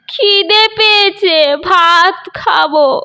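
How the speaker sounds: put-on slow, high, crying old man; tearful whine. Old grandfather whimpering to his son for rice. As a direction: whimper it like a hungry child.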